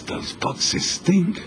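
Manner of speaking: slow, whispered disgust. Acted. Wife recoiling from the stinking boxes, slow disgusted whisper. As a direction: whisper it, nose wrinkled at the stench.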